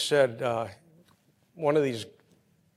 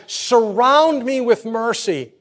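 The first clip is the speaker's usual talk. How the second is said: slow, high, loud plea; psalmist's loud pleading cry; crying out to God; 10 semitones higher, 12 dB louder, slower than usual. Retold or imitated. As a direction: cry out like the psalmist begging for mercy. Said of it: "He cried out and he asked God".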